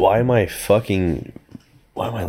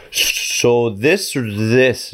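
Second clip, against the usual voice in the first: slow pointed question; 5 semitones higher, much slower than usual. Retold or imitated. Slow, deliberate question to nurse.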